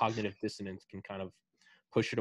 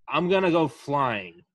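louder and slow, spelling out the bold plan; loud proud announcement; 5 semitones higher, 10 dB louder, slower than usual. Retold or imitated. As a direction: proclaim it like a wild inventor. Wright brother quoted in story.

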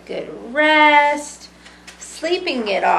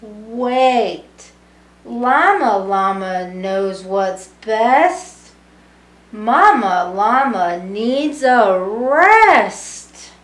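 lively sing-song storybook reading; reading to children; 8 dB louder, slower than usual. A narrator's reading. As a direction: read it warmly to a room of kids. Reading picture book to children.

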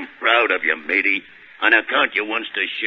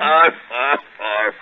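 arf laugh; 4 semitones higher, 5 dB louder, much slower than usual. Acted. Popeye's signature laugh at a joke.

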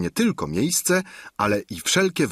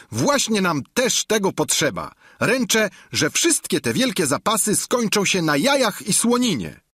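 higher, louder squire's voice; a plain-spoken squire, higher and louder; Sancho's voice; 11 semitones higher, 3 dB louder than usual. Acted. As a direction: Sancho voiced high and loud. Sancho mocking the empty larder.

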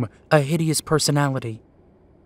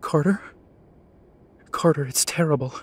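frantic, breathless whisper; trembling whisper; 4 dB quieter than usual. Acted. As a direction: whisper it in terror. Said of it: "those frenzied tones again".

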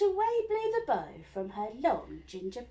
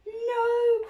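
much higher, louder frightened no; witch's cry; 13 semitones higher, 12 dB louder than usual. Acted. Witch fleeing the dragon, frightened cry. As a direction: scream it in fear. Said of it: "cried the witch".

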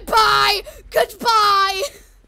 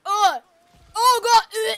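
ordinary talk

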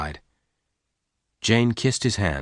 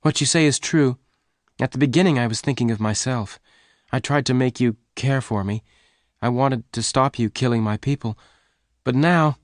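higher-pitched pleading woman's voice; earnest, apologetic heroine; 4 semitones higher than usual. Acted. Jane confesses to Lassiter.

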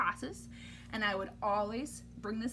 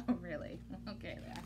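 soft, low bashful reaction; coy aside; 8 semitones lower, 12 dB quieter, faster than usual. Real, not acted. Reader reacting to the book's praise, soft. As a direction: play it modest.

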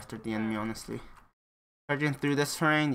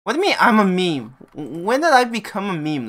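surprised; 6 semitones higher, 8 dB louder than usual. Real, not acted. Told by chat he's a meme.

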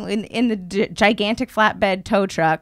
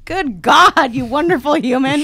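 louder, higher gush; overwhelmed with gratitude; gushing praise; 5 semitones higher, 4 dB louder than usual. Retold or imitated. Thanking the kind stranger, gushing louder.

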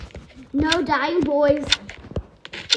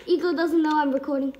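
ordinary talk

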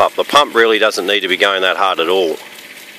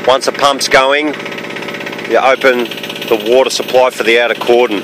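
louder, higher, projected over an engine; raised voice over noise; 5 semitones higher, 4 dB louder than usual. Real, not acted. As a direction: speak up over a running pump. Talking over the running pump.

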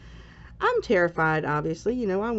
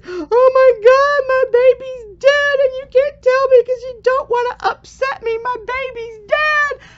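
hysterical screaming; 10 dB louder than usual. Retold or imitated. A mother screaming to doctors that her baby died, hysterical. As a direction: re-enact a hysterical cry, pitched far up. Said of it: "screaming and crying and in hysterics".

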